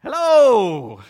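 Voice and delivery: loud, emphatic "hello" to wake the room; rousing call to listeners. Acted. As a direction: shout it out to get attention. Preacher calling out to congregation.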